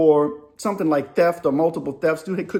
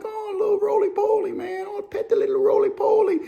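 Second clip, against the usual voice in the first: put-on much higher, cooing baby talk; cooing at a bug; 13 semitones higher than usual. Kid coos at a roly-poly in his hand, high and sweet. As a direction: coo over the bug like a small child. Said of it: "And you're like".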